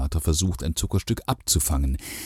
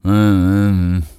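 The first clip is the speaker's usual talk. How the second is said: loud grumbling hm hm hm; grumpy hums; 4 semitones higher, 10 dB louder, slower than usual. A narrator's reading. Father grumbling loudly at his son. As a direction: grunt it out like a sulky old father.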